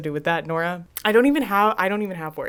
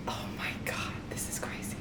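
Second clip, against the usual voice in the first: stunned, voiceless whisper; barely audible, real whisper; shocked whisper; 13 semitones lower, 16 dB quieter than usual. Real, not acted. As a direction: whisper it to yourself in disbelief. Stunned reaction to the book.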